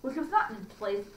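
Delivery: usual speaking voice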